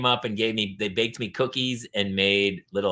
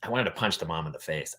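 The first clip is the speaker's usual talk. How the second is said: ordinary delivery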